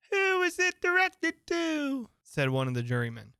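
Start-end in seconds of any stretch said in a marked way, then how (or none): 0.1-2.1 very high, slow squeak; squeaky juryman. A narrator's reading. Juryman asks about the letter, high squeaky voice. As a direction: draw out the question in a piping creature voice.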